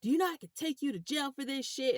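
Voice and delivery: indignant, high-voiced retold scolding; imitated angry cursing. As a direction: imitate an irate officer cursing out a driver. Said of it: "cursing me out".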